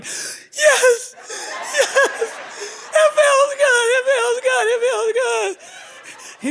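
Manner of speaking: high breaking cry, slowing at end; overwhelmed release; mock ecstatic crying; ecstatic, near tears. Thumb in her foot.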